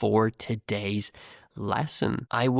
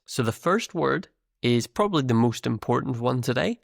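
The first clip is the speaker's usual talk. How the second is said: usual speaking voice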